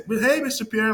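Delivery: high-pitched friendly greeting, imitated; office lady. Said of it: "like, the office lady".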